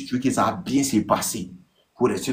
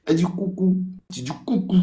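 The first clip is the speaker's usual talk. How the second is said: light girly voicing; 9 semitones higher than usual. Same rule repeated.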